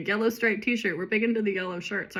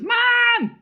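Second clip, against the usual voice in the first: high, loud shout for Mom; hammed-up, high-pitched holler; freaked-out call; 7 dB louder than usual. A narrator's reading. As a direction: yell for your mother in a panic. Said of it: "So she starts freaking out".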